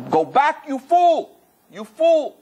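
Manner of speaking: high, loud booming command of the light; booming rejection. Retold or imitated. The light rejects the suicide, booming you fool. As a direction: cast the soul back with a thunderous rebuke.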